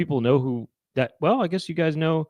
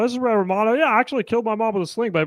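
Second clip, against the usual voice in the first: higher, faster Romano; Ray Romano voice; 8 semitones higher, faster than usual. Retold or imitated. Ray Romano doing Sling Blade, higher and fast. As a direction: rattle it off as Romano. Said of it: "sounds a little bit like this".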